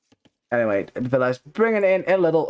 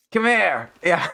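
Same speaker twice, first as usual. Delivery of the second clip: put-on drunk American yell; 3 semitones higher, slower than usual. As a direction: bark it like a drunk yelling. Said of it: "sounds like a drunk American yelling".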